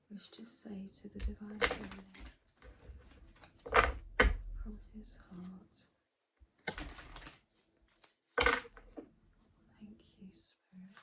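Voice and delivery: hushed unvoiced whisper trailing away. Real, not acted. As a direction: whisper it like a private prayer. Whispered invocation to spirit.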